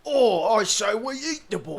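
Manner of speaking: hammed-up orc voice; orc English. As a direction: bellow it like a cockney orc. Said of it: "orc English".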